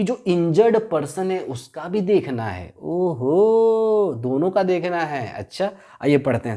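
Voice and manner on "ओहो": a long, drawn-out amazed ओहो; stretched student oho. Pretend. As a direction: play the student who suddenly gets it. (छात्र की तरह खिंचा हुआ हैरान ओहो)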